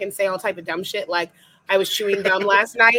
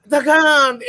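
dramatic gasp; 6 semitones higher, 5 dB louder than usual. Retold or imitated. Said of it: "And I was like".

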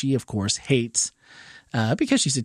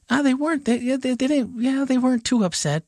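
higher, childlike voice imitating the daughter; 12 semitones higher than usual. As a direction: lightly play the young daughter answering. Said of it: "And I'm like".

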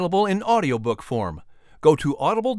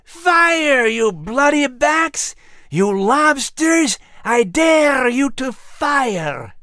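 much higher, slow, shouted dare; screaming taunt; 10 semitones higher, much slower than usual. Acted. Angry man in the crowd screaming at the soldiers to fire. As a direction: scream it at the soldiers, daring them. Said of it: "all the while screaming".

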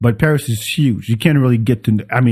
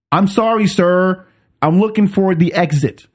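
apologetic; 7 semitones higher than usual. Retold or imitated. Apologizes to the cursing man.

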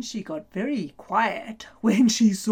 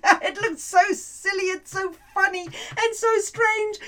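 laughing; 9 semitones higher than usual. A narrator's reading. Robot dance, narrator delighted.

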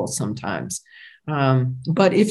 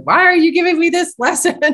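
mock yell at God; 11 semitones higher, 3 dB louder than usual. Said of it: "yell at God".

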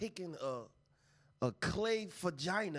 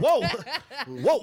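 surprised laugh; 6 semitones higher, 11 dB louder than usual. Real, not acted. Reacting to sexy line.

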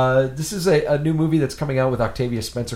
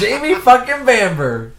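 excited gush; 9 semitones higher, 7 dB louder, slower than usual. Real, not acted. Gushing over a favorite actor.

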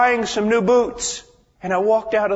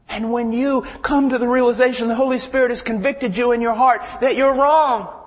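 higher and louder, building; rising preaching; 6 semitones higher, 4 dB louder than usual. Acted. Preacher urges believers not to heed the devil, building. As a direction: climb toward the climax.

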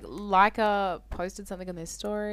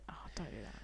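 whispered plea, much softer; 7 semitones lower, 16 dB quieter, much faster than usual. Real, not acted. Telling a friend not to cut his hair, whispered. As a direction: hush it, begging him not to. Quiet reply to haircut plan.

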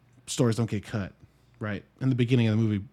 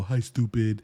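cooing at a dog, lightly; affectionate baby talk to a pet; fond pet talk; slower than usual. Retold or imitated. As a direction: say it like petting a dog. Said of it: "pet a dog and go".

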